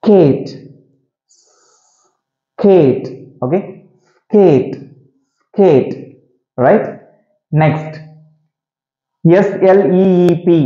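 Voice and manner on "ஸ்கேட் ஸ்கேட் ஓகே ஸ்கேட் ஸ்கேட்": long hissed s, then 'கேட்'; separate S first. Shown as a demonstration. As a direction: sound the s alone before each 'கேட்'. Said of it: "எஸ்-ஐ வாசிச்சுட்டு அடுத்து கேட்". ('ஸ்ஸ்' தனியாக, பிறகு 'கேட்')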